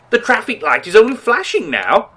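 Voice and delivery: ordinary talk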